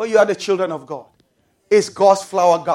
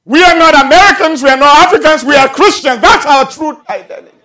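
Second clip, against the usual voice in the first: loud, raised-pitch sermon delivery; full-force preaching; 5 semitones higher, 10 dB louder than usual. Real, not acted. Preacher telling believers to leave the national spirit, full-force preaching.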